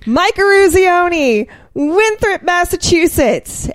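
high, slow, ringing call across the rink; player shouting out. Retold or imitated. Exhausted player to coach, shouting his name. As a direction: belt out his name and hometown. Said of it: "pipe up and say".

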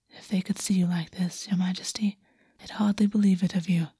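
soft unvoiced whisper, close to his ear; intimate whisper. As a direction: breathe the words like a secret. Lover whispering to the king.

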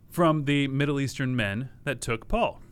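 normal talking voice

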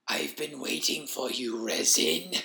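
slow, soft, crackling wheeze; wheezing creature. A narrator's reading. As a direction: wheeze it out through a lumpy throat. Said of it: "the voice wheezed".

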